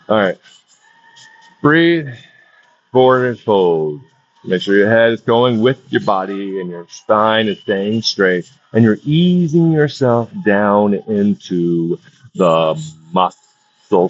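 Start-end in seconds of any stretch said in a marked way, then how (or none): none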